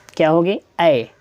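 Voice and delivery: usual speaking voice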